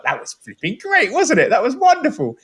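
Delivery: high, gushing delight; gushing nostalgia. Retold or imitated. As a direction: gush over it like a fond old fan. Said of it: "I can be like".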